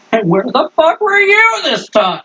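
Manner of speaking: high, excited call to a friend; character greeting. Acted.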